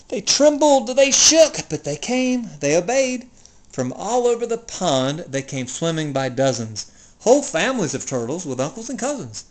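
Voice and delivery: ordinary delivery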